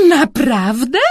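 a touch of a giggle; giggled question. A narrator's reading. Girl to the boy, lightly giggled. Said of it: "chichotała".

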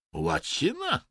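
high, dim-witted echo; dumbly puzzled. A narrator's reading. Vadim echoes the unexpected answer, dumbly. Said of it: "powtórzył głupkowato Wadim".

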